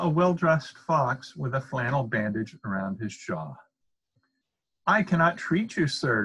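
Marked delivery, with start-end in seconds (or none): 4.9-6.2 raised voice refusing a caller; mild shout. A narrator's reading. Dentist refusing a fox at the door, mild shout. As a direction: call out higher, a touch louder. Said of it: "Doctor DeSoto shouted".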